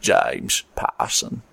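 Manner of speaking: low, slow, husky Cockney Michael Caine; deep Michael Caine accent, almost whispered; Michael Caine impression. Shown as a demonstration. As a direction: drop into a slow London Michael Caine growl. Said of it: "I'm just gonna do Michael Caine".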